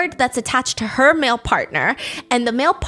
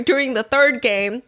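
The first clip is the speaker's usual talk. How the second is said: ordinary delivery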